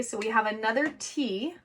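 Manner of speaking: ordinary talk, nothing marked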